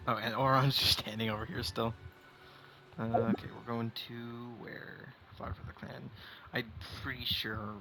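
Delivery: normal talking voice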